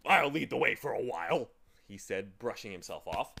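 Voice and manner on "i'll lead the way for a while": loud character voice; take-charge character. A narrator's reading. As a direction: announce it firmly to the group. Character takes charge after climb.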